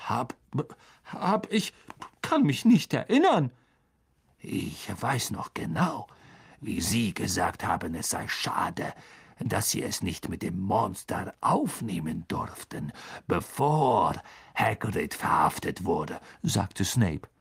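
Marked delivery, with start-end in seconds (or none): 0.1-3.5 higher, stumbling excuse; flustered Lockhart. A narrator's reading. Lockhart flounders. 4.4-16.2 cold teacher exposing a braggart; silky Snape. A narrator's reading. Snape mocks Lockhart.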